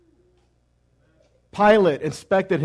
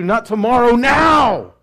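louder and rising to a high "now"; urgent shout; 5 semitones higher, 7 dB louder, slower than usual. Acted. Preacher urging the congregation that they need him now, shouting. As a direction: hit "now" like a demand.

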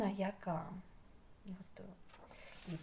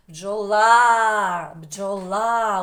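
бджола very loud with long-held ла; louder, stressed ending; 14 dB louder, much slower than usual. Shown as a demonstration. Teacher wants it louder once more, бджола belted on the stressed ла. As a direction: hit and stretch the last а of бджола. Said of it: "голосніше ще раз".

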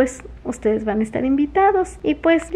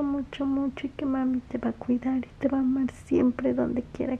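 hushed, much softer bedtime blessing, close to tears; tender blessing; 9 dB quieter than usual. Real, not acted. Mother blessing her baby at bedtime, hushed and teary. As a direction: bless him softly as he drifts off.

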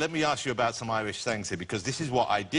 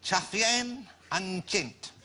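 very slow, higher, botched Irish with a Chinese-sounding ring; mistaken Chinese-sounding accent; 6 semitones higher, much slower than usual. Real, not acted. Novice reads Irish blind to a fluent speaker, Chinese-sounding. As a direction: sound out Irish as if it were Mandarin. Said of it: "It is not Mandarin Chinese".

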